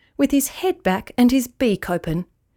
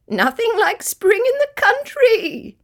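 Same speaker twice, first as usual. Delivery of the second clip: very high, mocking bird voice; chortling; 13 semitones higher than usual. A narrator's reading. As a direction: repeat it with gleeful mockery. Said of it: "he chortled".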